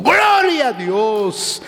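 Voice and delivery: high, long-stretched cry of glory; slow, high church shout; shouted praise. Acted. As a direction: act out a worshipper crying out praise. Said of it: "Si usted siente gritar".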